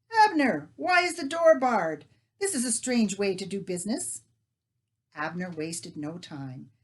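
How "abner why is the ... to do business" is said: muffled shout through a door, higher and louder; muffled call. Acted. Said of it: "A muffled voice called through".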